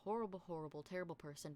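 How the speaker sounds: ordinary delivery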